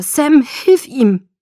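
loud, high, very slow anguished cry; anguished plea to a distant pack leader; desperate plea. Acted. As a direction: beg for help in agony. Edward pleads in pain.

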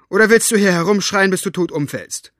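loud, high, fast gruff retort. Acted. Aunt gruffly cutting off the screaming girl. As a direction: snap back at the shouting girl. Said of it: "Ihre Stimme klang barsch wie immer".

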